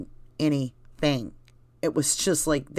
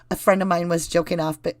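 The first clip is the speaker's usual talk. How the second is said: ordinary talk, nothing marked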